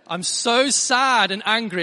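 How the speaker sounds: loud, high, stretched-out mock tantrum; hammed-up daughter's outburst; imitated shouting. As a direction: wail it slowly like an overdramatic child. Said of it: "she's shouting at the top of her voice".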